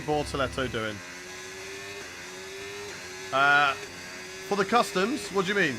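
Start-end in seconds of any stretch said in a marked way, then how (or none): none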